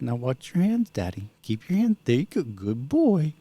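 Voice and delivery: high-pitched coddling parent voice; mock a parent fussing over a little child; teasing, singsong-high imitation of Dad; mocking dad's baby talk. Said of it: "You're mocking me, aren't you?".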